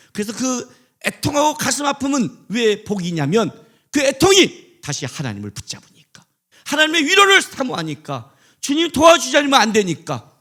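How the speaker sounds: loud, high preaching; full-force sermon climax; fiery preaching. Acted. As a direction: thunder it from the pulpit. Preaching builds again.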